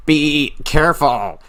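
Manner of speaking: high, slow exasperated scolding; frustrated mother. Retold or imitated. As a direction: draw it out like a fed-up mom. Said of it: "but there's also frustration".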